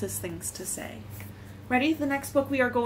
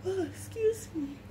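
soft, higher, timid little plea; mildly scared; 7 semitones higher, 3 dB quieter than usual. A narrator's reading. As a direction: ask softly as a nervous truck. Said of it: "and he sounded scared".

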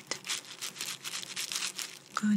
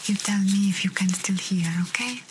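usual speaking voice

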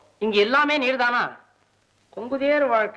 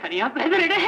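raised, wailing cry; panicked self-realisation; 3 semitones higher than usual. Acted. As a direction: repeat it with mounting alarm. Same realisation, now panicked.

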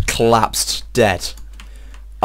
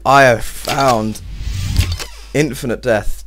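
mock grand reveal; slower than usual.

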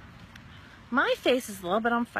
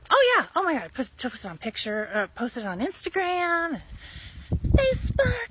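high, louder, fast gushing friend voice; gushing girlfriend; 6 semitones higher, 5 dB louder than usual. Retold or imitated. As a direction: play the friend bubbling with enthusiasm.

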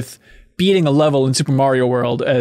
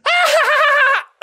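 high, shouted witchy cackle; cackly cartoon laugh. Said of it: "it sounds too cackly".

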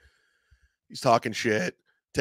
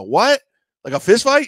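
loud high disbelief; can't believe what you're hearing; shocked; 10 semitones higher, 8 dB louder than usual. Retold or imitated. Shocked reaction to fight news.

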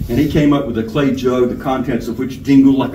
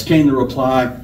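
ordinary delivery, nothing marked

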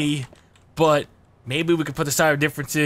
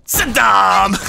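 loud, raised shout of a name; calling out; 3 semitones higher, 5 dB louder than usual. Pretend. Player hailing Saddam in game, calling out loudly. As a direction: holler it across to a rival.

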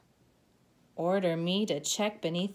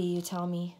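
usual speaking voice

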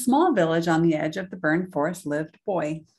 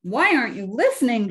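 louder, higher exasperated question; storybook shout; 6 semitones higher, 6 dB louder than usual. A narrator's reading. King to Boy who cannot hear, shouting. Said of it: "shouted the king".

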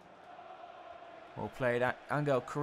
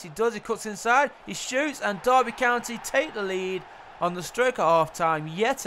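louder, higher rush of commentary; excited goal call; 5 semitones higher, 4 dB louder than usual. Real, not acted. Commentator calls Derby County taking the lead, excited.

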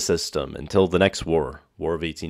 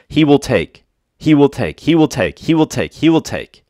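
"will take" punched higher each time; hammering repetition; 4 semitones higher than usual. Shown as a demonstration. Preacher stressing God's warning to the congregation, insistent and building. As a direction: drive home "He will take" with rising insistence. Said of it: "look at the language he uses over and over again".

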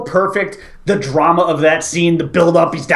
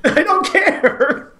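slow laughing repeat; laughing deadpan; 13 semitones higher, much slower than usual. Retold or imitated. Repeating the Tommy Lee Jones line to his friend, laughing. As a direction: drag the words out through a laugh. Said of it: "Tommy Lee Jones says".

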